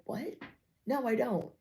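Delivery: high, soft startled answer; taken aback denial. Retold or imitated. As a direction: caught off guard. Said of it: "You're like".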